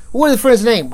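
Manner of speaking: retold exasperated questioning; rude interrogation. Officer pressing detainee for a name, rude. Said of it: "I was rude to her".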